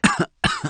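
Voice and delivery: a sudden rough hacking cough; harsh cough. Acted. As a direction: cough as if dust caught in the lungs. Lungs irritated after battle.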